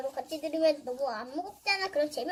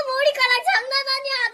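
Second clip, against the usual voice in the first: higher and louder, with 장난 pushed and falling; loud retake; 7 semitones higher, 9 dB louder than usual. Pretend. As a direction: pump up the word 장난. Repeated line, louder. (장난을 크게 강조하며)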